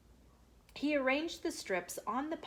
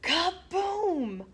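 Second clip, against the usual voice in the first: plain reading voice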